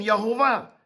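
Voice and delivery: ordinary talk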